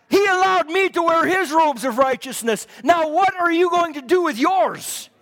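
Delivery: forceful, ringing sermon delivery; full-force preaching. Real, not acted. Preacher urging the church to roll the stone away, preaching hard. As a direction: preach it out with driving conviction.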